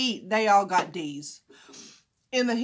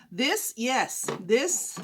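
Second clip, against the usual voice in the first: usual speaking voice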